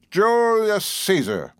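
high, long-drawn proclamation; grand announcement. A narrator's reading. Perry turning from the mirror as Caesar, grandly announced. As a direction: announce it grandly, stretching the first word. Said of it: "announced Perry".

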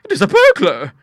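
high, loud alarmed cry; shocked at a burglar; frightened old lady. Acted. Bertha crying out at a burglar, high and scared.

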